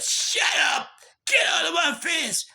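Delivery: furious high-pitched shout; yelling. Retold or imitated. Man shouting back at the woman in his face, furious yell. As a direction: explode in anger and yell it out. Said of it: "I was yelling and everything".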